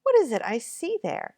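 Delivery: high astonished question. Acted. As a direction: gasp it out in wonder. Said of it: "quite astonished".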